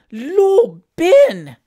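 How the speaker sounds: high, loud cry of surprise; surprised. A narrator's reading. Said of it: "a tone which expressed more surprise than pleasure".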